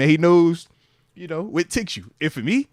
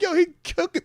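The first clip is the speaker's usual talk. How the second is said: laughing amazement; 13 semitones higher than usual. Retold or imitated. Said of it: "And I'm just like".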